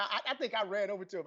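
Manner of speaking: ordinary delivery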